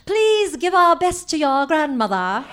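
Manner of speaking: lightly put-on Kennedy-esque upper-class accent; half Katharine Hepburn, half the Queen, a Kennedy-esque lilt; aunt imitating a Kennedy-esque accent to the boys. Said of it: "Kennedy-esque way of speaking".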